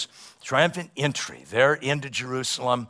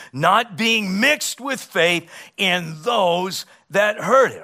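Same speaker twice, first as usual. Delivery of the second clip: high, loud, slowed reading of the verse; preached scripture reading; 7 semitones higher, 6 dB louder, slower than usual. Acted. Preacher reading Hebrews to his congregation, proclaiming.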